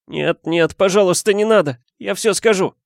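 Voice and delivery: high sobbing plea, begging; wailing plea. Acted. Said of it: "заревев".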